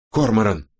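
abrupt, urgent calling of a name; sharp call. A narrator's reading. Robin urgently summons her partner, sharp call. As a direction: call out to get his attention at once. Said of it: "резко окликнула Робин".

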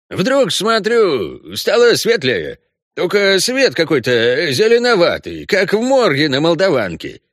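high, lively Odessa storyteller; Odessa Russian. A narrator's reading. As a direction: spin the yarn in Odessa accent. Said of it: "неповторимым одесским говором". (одесский говор рассказчика)

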